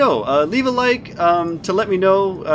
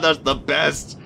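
ordinary talk

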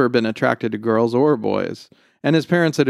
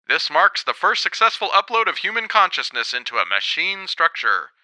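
pitched-up news delivery; TV reporter; 6 semitones higher than usual. Acted. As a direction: report it crisply to camera. The reporter was saying.

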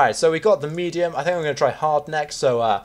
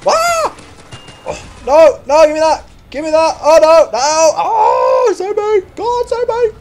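loud high frantic screams; panicked screaming; 8 dB louder, slower than usual. Real, not acted. Gamer grabbed in game, screaming for help. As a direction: beg for rescue in panic.

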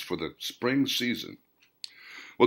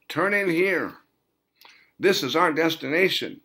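higher, distinct voice for the companion; a companion calmly pointing the way; character voice; 8 semitones higher than usual. Acted. Voltarion gives directions to Hans.

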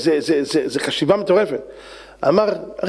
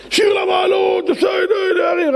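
high, slow, shouted chant; 12 semitones higher, slower than usual. Acted. Rabbi chanting psalm loudly.

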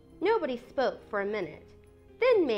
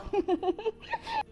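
high giggling laugh; amused cackle at a sister's blunder; mocking laugh; 12 semitones higher than usual. A narrator's reading. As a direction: burst into teasing high laughter. Jo laughs at Amy's mistake.

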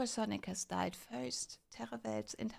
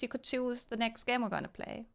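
ordinary talk

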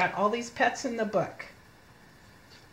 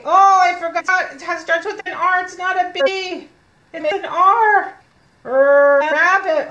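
loud, high outburst on catching a slip; exclaimed self-correction; 8 semitones higher, 10 dB louder than usual. Real, not acted. Teacher realizing it starts with an R, loud exclaimed correction.

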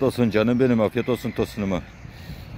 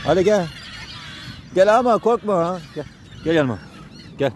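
coaxing animals; 6 semitones higher than usual. Real, not acted. Feeding timid strays, calling them over gently, high sing-song coaxing. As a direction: coax a shy stray dog to come close.

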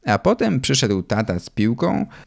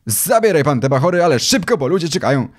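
higher, moderately raised owner's demand; acted annoyance, pitched up and pressing; irritated calling out; 6 semitones higher, 3 dB louder, faster than usual. A narrator's reading. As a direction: order them away impatiently. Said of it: "zawołał do mojego tatę".

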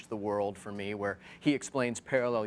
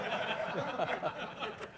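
laughing; 10 semitones higher, 5 dB quieter than usual. Real, not acted. Host laughs at own joke.

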